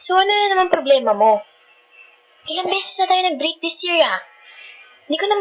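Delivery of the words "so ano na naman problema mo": higher-pitched exasperated demand; fed up, confronting a partner. Acted. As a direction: pitch up in annoyed disbelief. Acted lovers' quarrel, exasperated.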